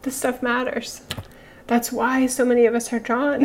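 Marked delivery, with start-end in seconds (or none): none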